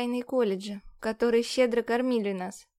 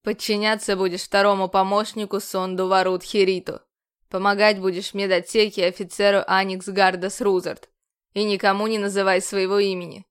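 louder, clipped military orders; stern commander; 3 semitones lower, 7 dB louder than usual. A narrator's reading. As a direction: command like a strict officer.

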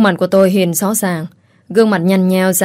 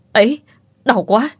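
mild cry of pain; 4 semitones higher than usual. A narrator's reading. Servant yelps to his master as he is bitten, mild cry of pain. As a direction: cry out in pain, held back. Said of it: "khiến nó phải hét lên".

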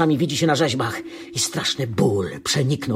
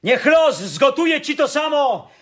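furious curse. Acted. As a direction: hurl the curse after her at the top of the voice. Son curses departing mother.

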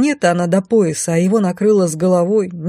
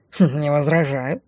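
snooty bored cat; 3 semitones lower than usual. Acted. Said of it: "фыркнул кот".

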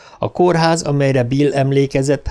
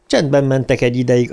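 ordinary delivery

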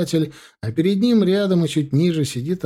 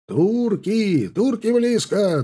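high, slow cry from far off; distant shout; 8 semitones higher, much slower than usual. Acted. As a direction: call out the alarm from afar. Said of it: "донёсся крик от подскакивающего дозора".

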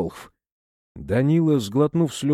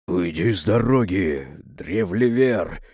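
hoarse croak; 5 semitones lower than usual. A narrator's reading. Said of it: "хрипло каркнул Хрисанф".

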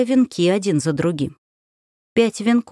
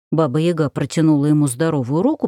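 usual speaking voice

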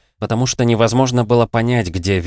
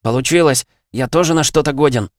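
higher-pitched triumphant exclamation; elated announcement, moderately raised voice; excited cry; 7 semitones higher, 4 dB louder than usual. A narrator's reading. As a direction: cry out your success, voice lifted. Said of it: "и с криком".

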